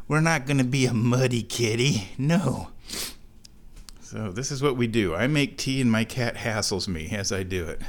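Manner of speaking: ordinary delivery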